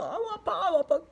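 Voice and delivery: high, hammed-up wail of pain; mock crying. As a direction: act out a child bawling from a hurt. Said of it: "나는 계속 울었어". (아파서 엉엉 우는 시늉)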